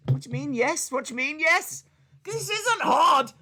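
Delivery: high, loud exasperated yelp at the phone. Real, not acted. Yelling at Google that won't answer, exasperated.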